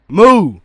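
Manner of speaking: a loud playful moo; mock cow moo. Telling the boss about a cow, a loud mock moo.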